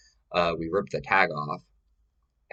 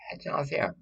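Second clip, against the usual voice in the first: put-on whiny child; 9 semitones higher, 4 dB quieter than usual. Child scolded and sent to couch.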